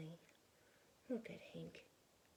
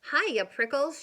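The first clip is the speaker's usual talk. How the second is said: loud, higher teasing greeting; shouted; 4 semitones higher, 10 dB louder than usual. Acted. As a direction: holler it while dashing by. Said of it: "she shouted".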